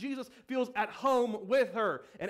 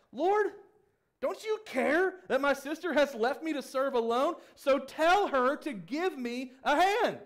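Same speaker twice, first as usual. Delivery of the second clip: louder, higher, pleading complaint; exasperated plea; 5 semitones higher, 4 dB louder, slower than usual. Acted. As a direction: push the frustration at the Lord. Martha complains to Jesus.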